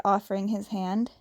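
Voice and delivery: plain reading voice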